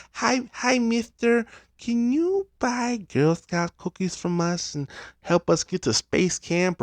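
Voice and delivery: put-on high, sweet little girl pitch; cute kid selling cookies. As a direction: chirp it like a small Girl Scout. Girl scout voice imitated.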